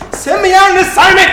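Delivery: high, loud, eager shout; bursting to get going; excited plea. Acted. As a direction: beg loudly, bursting with eagerness. Begging boss for a job.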